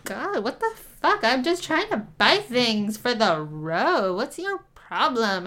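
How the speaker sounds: put-on high, slow, offended teen whine; teen girl snapping back at the clerk, high and slow. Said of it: "And she's like".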